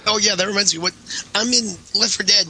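normal talking voice

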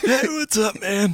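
put-on high, cocky teen dealer voice; sulky teen mumble. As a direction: pitch it up like a shady teen hustler. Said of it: "a teenager who's trying to sell me".

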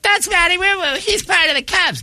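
put-on high, gushing fan girl; starstruck girl voice. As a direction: squeal it like an impressed fan. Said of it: "chicks are like".

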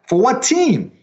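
high-pitched imitation of a puzzled listener; mock bafflement. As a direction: toss out the question in comic confusion. Retelling people baffled by his job.

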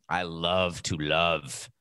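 slow, dramatic actor's delivery; milk it like a stage actor; hammy stage actor. Pretend. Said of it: "I say it like an actor now".

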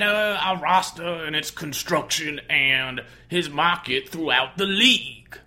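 lazy, syrupy [name] take on a GM; Doctor John impression. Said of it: "It's a little foghorn leghorny".

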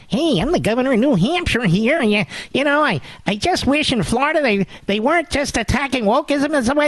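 high, mocking voice of a wishy-washy governor; mocking Sununu. Host mocking the New Hampshire governor, high mock voice. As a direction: pitch it up and sneer at a weak politician. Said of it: "if you come into this situation".

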